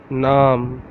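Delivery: Naam with a long open "aa"; Urdu word. Shown as a demonstration. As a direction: stretch the aa in Naam. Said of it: "How to say in Urdu?".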